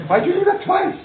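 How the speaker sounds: outraged falsetto question; mock indignant high cry. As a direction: squeal it like an offended rabbi roused at dawn. Mock indignant rabbi at five am.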